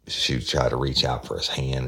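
normal talking voice

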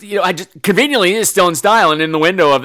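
normal talking voice

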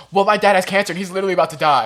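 loud, fast mock one-upper; one-upping complaint. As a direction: blurt it out to top everyone. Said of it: "Someone else goes".

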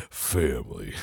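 deep, slow, soft action-hero voice; Vin Diesel impression. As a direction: say it low and solemn like Vin Diesel. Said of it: "Vin Diesel".

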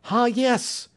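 high-pitched exclamation of discovery; excited cry. Acted. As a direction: cry out high on finding the clue. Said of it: "he cried".

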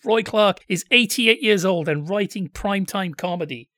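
ordinary talk, nothing marked

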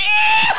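very high burst of laughter; high laughing shriek. Real, not acted. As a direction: cackle at the picture.

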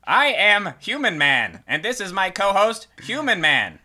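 slow, high, stiff alien host; robotic alien voice. Acted. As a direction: play an alien pretending to be human. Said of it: "you went with that bit".

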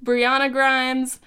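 ordinary talk

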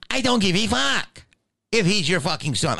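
high-pitched retold outburst; exasperated retort. Comic answering a protective mother, exasperated. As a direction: throw the excuse back, voice way up.

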